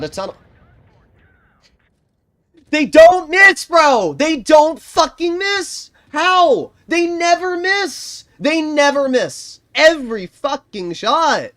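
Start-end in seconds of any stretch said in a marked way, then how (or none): 2.7-11.5 furious high-pitched yelling, much louder; real rage. Real, not acted. Gamer fuming at enemies who never miss, furious yelling. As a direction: yell in disbelief, drawing out the words. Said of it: "I'm getting so mad at this game".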